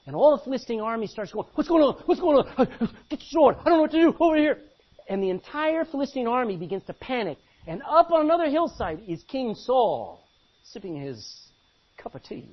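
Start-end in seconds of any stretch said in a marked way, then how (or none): 1.5-4.6 loud, high frantic shouting; loud high panic; panicked soldiers. Acted. Philistine army panicking. 10.6-12.5 soft, near-whispered jab; quiet mocking aside; hushed aside. Mocking Saul's comfort.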